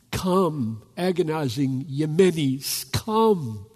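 rising, urgent invitation; impassioned altar call. Acted. Preacher inviting every nation to come, urgent and rising. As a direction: call each nation in by name.

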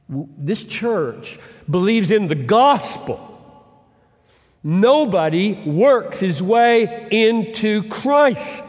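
loud, slow preaching burst; preaching at full force. Acted. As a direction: proclaim it loudly to the congregation.

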